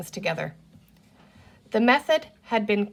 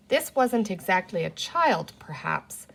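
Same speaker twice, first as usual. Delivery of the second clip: usual speaking voice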